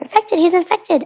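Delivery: panicked yelling; panicked shout. Acted. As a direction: shriek it in a panic. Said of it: "We all screamed and shouted".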